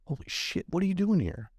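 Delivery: high-pitched imitated surprise; mock stunned, pitch shooting up; startled disbelief. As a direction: mimic someone caught like a deer in headlights. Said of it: "like deer in headlights".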